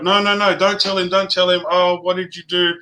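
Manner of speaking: imitating a frantic plea not to tell; panicked pleading. Daughter begging mum to keep the smoking secret, panicky and high. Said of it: "mum was like".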